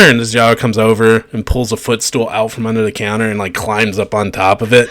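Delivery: ordinary delivery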